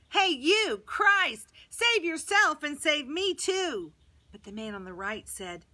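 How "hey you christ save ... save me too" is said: high, loud heckle; jeering. A narrator's reading. Thief on his left joins the laughing crowd, jeering. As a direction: call out mockingly, stretching the last words. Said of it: "laughing with everyone else".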